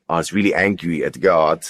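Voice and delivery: ordinary talk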